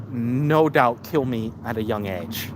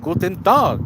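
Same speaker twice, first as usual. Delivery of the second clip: mock German greeting; 5 semitones higher, 4 dB louder than usual. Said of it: "'Cause you're not German".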